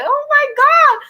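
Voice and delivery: high-pitched, loud cringe; mortified exclamation. Retold or imitated. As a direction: wail it in mock horror at yourself. Acting out horror at own video.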